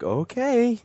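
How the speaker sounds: high, drawn-out reluctant okay; mock clerk. Clerk reluctantly agreeing, high-pitched. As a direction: give in like a baffled clerk.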